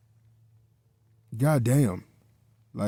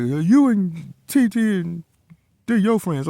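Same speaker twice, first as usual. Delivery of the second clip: higher, slow mock voice of his girlfriend; imitating girlfriend; 7 semitones higher, 4 dB louder than usual. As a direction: mimic her accusing him, a bit hammed-up. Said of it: "she was like".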